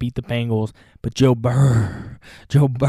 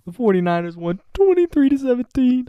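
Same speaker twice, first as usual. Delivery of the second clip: high, hammed-up lament; mock anguish; 10 semitones higher than usual. Cowboys fan to viewers after the loss, hammed-up high lament. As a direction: wail like a heartbroken fan, tongue in cheek. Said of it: "I'm in pain".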